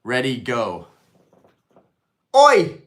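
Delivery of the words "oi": loud high cockney Oi; shouted British Oi; British accent. Pretend. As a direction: bark it like a London geezer. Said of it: "best British accent".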